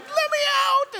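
high squeaky dog plea; pleading dogs. Retold or imitated. Preacher voicing his dogs begging to go out, high plea. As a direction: beg to go out like a tiny dog. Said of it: "they're just sitting by the door going".

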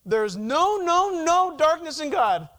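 preacher's rising insistence; emphatic repeated no. Shown as a demonstration. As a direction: hammer each "no" harder. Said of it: "We would maybe say".

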